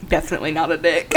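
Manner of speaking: fast, giggling repeat; laughing. Retold or imitated. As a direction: laugh through the words. Repeats friend's line laughing.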